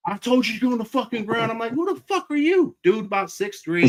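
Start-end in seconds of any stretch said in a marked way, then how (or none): 0.1-1.5 officer's command, re-enacted higher; mock barked order. Acting out officer ordering him down. 1.7-2.8 high-pitched, louder incredulous demand; indignant challenge. Retold or imitated. Retold confrontation with the man.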